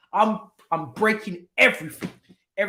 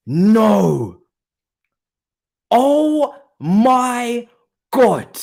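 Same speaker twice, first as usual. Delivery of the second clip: normal talking voice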